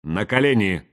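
toneless, even command; flat monotone. A narrator's reading. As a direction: order it without any intonation. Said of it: "её ровный, лишённый интонаций голос".

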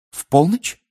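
high, startled echo; alarmed question. A narrator's reading. Hermione echoes the midnight hour back, alarmed. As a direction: jump up in pitch, taken aback. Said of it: "испуганно переспросила Гермиона".

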